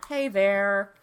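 loud, slow pitchman greeting; hammed-up infomercial host voice. A host playing the TV pitchman for her co-host, loud and slow. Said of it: "I sound so much like a, an infomercial".

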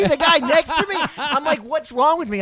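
high, loud, laughing disbelief; laughing outrage. Retold or imitated. Customer skipped for a free burrito, laughing outrage. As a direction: play up the indignation through laughter.

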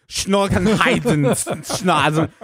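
slow, loud mock German name. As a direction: ham up a made-up German name. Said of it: "he had a very heavy accent".

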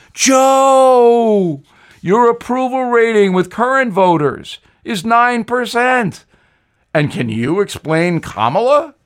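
a ghostly Southern warning to Joe; Jimmy Carter. Acted. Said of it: "I'd know that accent anywhere".

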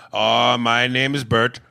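put-on loud, slow Indian accent; mock Indian call-centre agent giving a name; drawn-out put-on Indian accent. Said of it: "have an Indian male accent".

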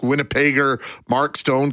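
ordinary delivery

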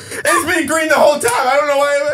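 high, shouted confession; mock scream. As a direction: scream it like a drunk friend. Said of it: "He screamed".

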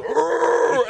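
high mock bear growl; mock bear grunt; bear growl imitation.

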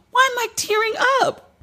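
choking back sudden tears; crying while trying to talk; tearing up. Real, not acted. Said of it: "I'm really sitting here crying".

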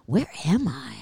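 whispered mock bewilderment; hushed, lost-sounding retold question; disoriented whisper. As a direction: whisper it as if just waking somewhere strange. Retold waking in strange hotel room.

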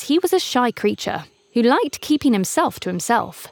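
usual speaking voice

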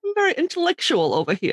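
high, quick mock-intellectual British; fake British accent. Co-host claiming to be intellectual, fake British accent. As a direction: tease with a put-on British voice. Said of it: "like, a fake British accent on the podcast".

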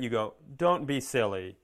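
ordinary talk

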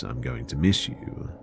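plain reading voice

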